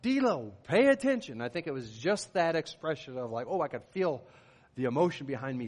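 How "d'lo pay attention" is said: sharp, loud, high shout at a player; yell. Retold or imitated. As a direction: bark it across the field. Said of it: "I had to yell out".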